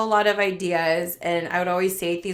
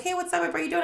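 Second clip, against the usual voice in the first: higher, softer chirpy hello; breezy mock hi, noticeably higher; imitating a friendly greeting; 9 semitones higher, 4 dB quieter, much faster than usual. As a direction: play her casual upbeat greeting, pitched up. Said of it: "You're like".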